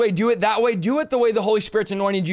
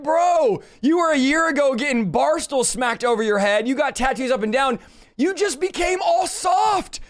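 retold rebuke building to a shout; 5 semitones higher than usual. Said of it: "I was like".

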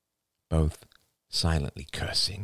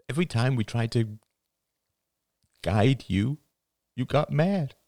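higher, pained confession; father character, tearful; 8 semitones higher than usual. Acted. Father confessing he couldn't guide daughter, choked up. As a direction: plead through rising emotion.

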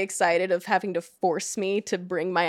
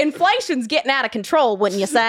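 put-on Southern accent; 3 semitones higher, 4 dB louder than usual. Guest repeats the line in her thickest Southern accent. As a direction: play a Southern neighbor griping about prices. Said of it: "in my thickest, uh, Southern accent".

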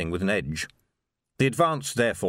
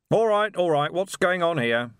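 high, louder call across the barricade; shouted; 7 semitones higher, 3 dB louder than usual. A narrator's reading. Vimes calling out to the people behind the barricade, loud. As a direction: call out with cupped hands. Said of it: "he shouted".

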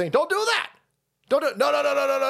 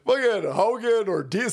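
usual speaking voice

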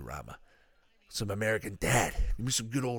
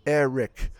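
put-on Fez's funny accent; 6 semitones higher, 10 dB louder than usual. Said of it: "And he goes".

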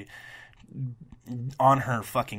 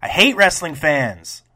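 loud, fed-up exasperation; exasperated outburst; 3 semitones higher, 10 dB louder, slower than usual. Real, not acted. Podcaster venting at rude wrestling fans, loud frustrated outburst. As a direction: blow up at the fans, then let it land. Ranting at fans who complain.

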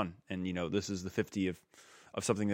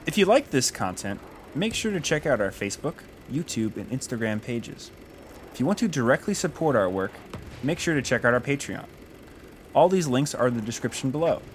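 upbeat, louder announcer sign-off; podcast outro read; 6 semitones higher, 6 dB louder than usual. Acted.

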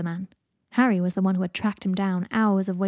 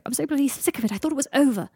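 raised, higher-pitched exasperated whine; fed-up outburst; 6 semitones higher, 4 dB louder, faster than usual. Acted. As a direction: complain bitterly, at wit's end. Draco rails against his lasting pain.

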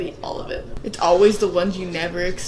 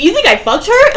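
loud, higher mock outrage; imitated indignant yell; 10 semitones higher, 13 dB louder than usual. Acting out his denial in the hallway, imitated indignant yell. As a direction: yell the question in mock disbelief.

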